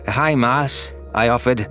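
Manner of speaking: plain reading voice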